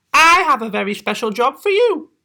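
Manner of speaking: high, bright mother voice; sweet high mum asking a favour; mother's voice. Acted. Mother voice in story.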